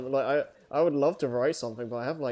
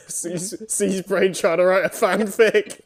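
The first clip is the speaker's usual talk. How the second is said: laughing through the joke, slow and louder; 7 semitones higher, 7 dB louder, slower than usual. Real, not acted. Teasing friends about co-writing a fanfic, laughing. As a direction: chuckle it out as you tease.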